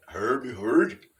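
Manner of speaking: mock Swedish Chef accent on "Heard, heard". Likening Sasquatch speech to the Muppets chef, in Swedish Chef accent. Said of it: "if you remember the chef from the Muppets".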